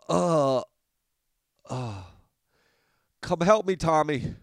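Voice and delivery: loud, high, hammy wounded cry; overacted death scene. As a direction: ham up a dying hero calling for help. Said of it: "I would pretend like I was hurt".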